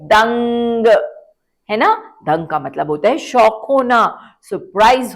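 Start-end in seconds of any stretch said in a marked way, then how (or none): 0.0-0.9 दंग with a clear nasal ng; repeat demo. Shown as a demonstration. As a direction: say दंग once more for the class. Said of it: "फिर से बोलिए".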